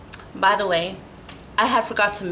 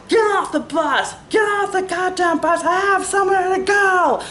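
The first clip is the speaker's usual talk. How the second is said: put-on drunk passenger yelling; 10 semitones higher than usual. Clown-nosed passenger yelling at her, mock shout. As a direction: yell like a guy who finally feels like the man. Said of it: "yelling and screaming".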